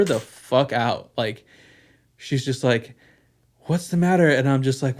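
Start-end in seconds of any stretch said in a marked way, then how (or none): 3.7-4.3 worried wife. Retold or imitated. Wife alarmed as he cries.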